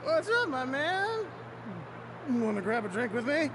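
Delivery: put-on loose, tipsy, cheerful drunk. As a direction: sound happily drunk. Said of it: "what a drunk voice".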